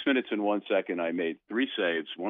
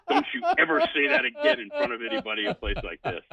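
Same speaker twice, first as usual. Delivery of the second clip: embarrassed dad scolding; 7 semitones higher than usual. Retold or imitated. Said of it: "I grabbed her and I said".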